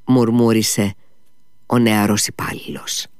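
ordinary delivery, nothing marked

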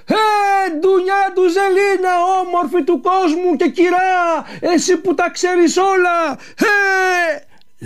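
high, drawn-out joyful calling; a long, high, sung-out call. A narrator's reading. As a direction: call her name across the plain from far away. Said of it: "άρχισε να φωνάζει".

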